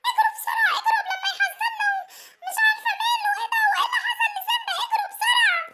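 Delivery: high panicked cries to run, half laughing; panicked fleeing. Acted. She warns the animals to flee Simba, high panicked. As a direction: scream at everyone to run.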